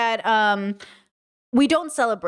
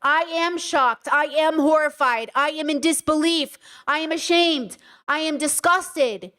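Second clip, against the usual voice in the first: each "I am" slow and higher, firmly stressed; emphatic declaration; 3 semitones higher than usual. Shown as a demonstration. Showing chat what to say instead, emphatic. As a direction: declare "I am shocked" word by word, pitched up. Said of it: "to say instead".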